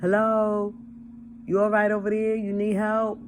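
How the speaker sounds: high, loud call across a distance; yelling out. Shown as a demonstration. Demonstrating calling out to a stranger lying on the ground, loud yell. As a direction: holler over to the stranger on the ground. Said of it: "I'ma yell out".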